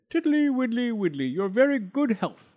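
a greedy toad guest, all fawning thanks; high singsong toad; Mister Jackson the toad. Acted. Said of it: "He sat outside ... and said".

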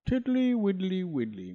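high singsong toad voice with a smile; high smiling singsong. Acted. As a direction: sweetly wheedle. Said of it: "said the smiling Mister Jackson".

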